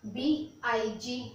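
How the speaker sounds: "बी आई जी" as three slow separate letters; spelled letter by letter. Shown as a demonstration. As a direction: spell out "बी आई जी" very slowly, letter after letter. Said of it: "मैंने क्या बोला".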